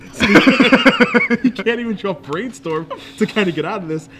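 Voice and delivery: high, loud, laughing through the words; cracking up mid-sentence. Real, not acted. Laughing at opponent's misplay.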